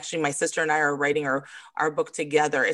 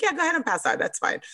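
much higher breezy dismissal; mock carefree shrug-off; unbothered coach; 12 semitones higher, faster than usual. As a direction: coach brushing off a fainting client. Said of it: "I was like".

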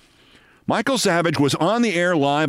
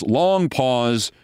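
ordinary delivery

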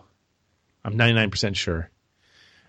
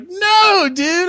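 loud retort; 3 dB louder than usual. Retold or imitated. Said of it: "And I said".

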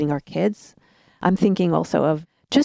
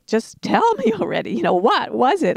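impatient; 6 semitones higher than usual. Retold or imitated. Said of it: "manage my own anxiety".

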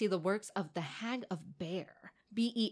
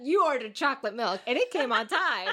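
laughing; 5 semitones higher, 5 dB louder than usual. Real, not acted. Mocking Denny's proposal.

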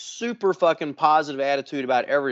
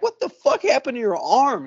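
blurted out high, caught off guard; shocked; 9 semitones higher, 3 dB louder than usual. Retold or imitated. Retelling his shock at the friend's scarred arm, voice jumping high. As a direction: gasp it out at the sight of the scar. Seeing Jeff's mangled arm.

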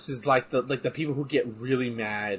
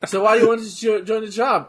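loud interviewer; 8 semitones higher, 7 dB louder than usual. Pretend. Mock job interview question.

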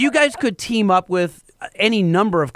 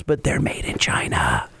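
whisper; 5 dB quieter than usual. Retold or imitated. Acted quote, whispered.

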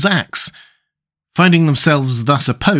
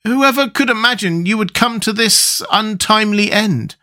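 higher, sorrowful voice; Tin Woodman, grieving; 8 semitones higher than usual. Acted. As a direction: mourn the friend.

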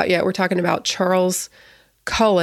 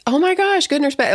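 high-pitched, excited self-quote; acted-out eureka moment in a raised voice; retold excitement; 8 semitones higher than usual. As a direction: relive the thrill of a new idea, pitched up. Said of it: "I remember how excited I was".

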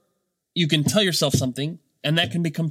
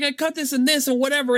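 imitating an excited child, pitched way up; super excited; 11 semitones higher than usual. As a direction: retell it in a high, thrilled rush of pride. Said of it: "she was super excited".

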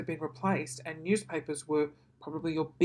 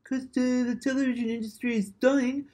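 higher, put-on whiny network voice; hammed-up higher voice, held throughout; mocking TV network; 6 semitones higher, 6 dB louder than usual. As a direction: mimic a money-hungry broadcaster mockingly. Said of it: "They're like".

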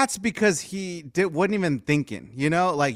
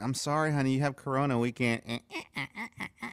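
mock prissy refusal; 4 semitones lower, 8 dB quieter, faster than usual. Mimicking wife refusing him.

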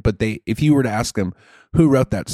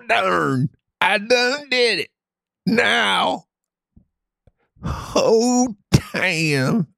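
hammed-up high hillbilly hoots; 8 semitones higher than usual. Redneck character hollering, playing.

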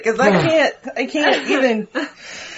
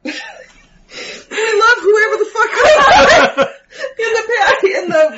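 laughing; 7 semitones higher, 3 dB louder, slower than usual. Real, not acted. Laughing at name reading.

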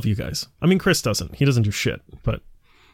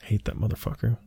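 muttered aside; 7 semitones lower, 9 dB quieter than usual. Real, not acted. Grumbles as cohost returns.

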